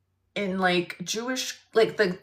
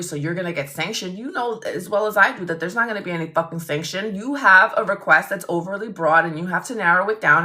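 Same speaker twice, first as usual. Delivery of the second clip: rapid-fire scolding, building to a punchy finish; angry comeback; 3 dB louder, faster than usual. Retold or imitated. Lawyer refusing opposing counsel's discovery threat, retold as fast angry rant. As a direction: lay down the law, fast and heated.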